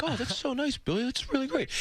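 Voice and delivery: high, far put-on sweet voice; a high, hammed-up innocent party guy; high mock Kreischer. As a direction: chirp it like an oblivious guy.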